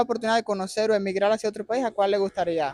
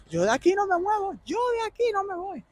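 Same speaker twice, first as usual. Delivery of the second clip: much higher, sing-song mock of the stubborn locals; mocking imitation; 11 semitones higher than usual. Host mocking interviewees who say they won't emigrate, high mock voice. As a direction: parody the people who refuse to leave. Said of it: "ya están diciendo".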